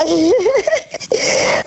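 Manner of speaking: helles, lautes Lachen; hohes Auflachen. Real, not acted. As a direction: lach los über die Erinnerung. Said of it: "Also ich muss selber lachen". (kicherndes Auflachen)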